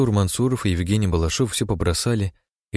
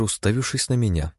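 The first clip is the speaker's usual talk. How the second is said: ordinary delivery, nothing marked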